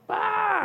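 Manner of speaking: very high startled scream; shocked shriek. Retold or imitated. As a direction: squeal in disbelief. Buddies see the mugshot.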